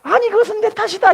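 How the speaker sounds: put-on high, loud old father-in-law; old man taking blame. Father-in-law insisting the fault is his, high and loud. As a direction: exclaim it's his own fault. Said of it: "시아버지가".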